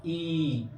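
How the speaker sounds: ई drawn out for two beats; long ee. Shown as a demonstration. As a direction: hold the ई long. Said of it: "दीर्घ ई को कैसे उच्चारण करेंगे". (ई को लंबा खींचकर बोलें)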